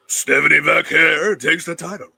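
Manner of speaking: loud, hammed-up French accent; mock-French accent at full voice; bad French accent. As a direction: announce it in a deliberately terrible French accent. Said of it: "That's my worst French, French accent".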